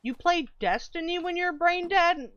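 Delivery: high disbelieving question; can't believe what they're hearing; incredulous. Real, not acted. Streamer mock-incredulous at chat.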